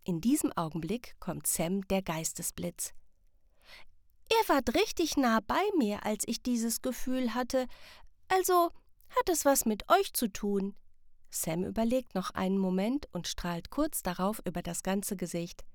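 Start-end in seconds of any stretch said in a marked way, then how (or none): 4.3-10.8 a lightly voiced child, higher, working it out; eager discovery. Sam figuring out the feeling with the parents, eager. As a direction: build to the idea.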